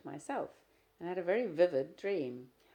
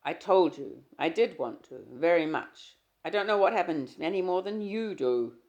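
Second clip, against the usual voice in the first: exasperated; 3 semitones higher, 10 dB louder than usual. Acted. Husband insisting to his wife, loud and exasperated. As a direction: raise the voice in frustration.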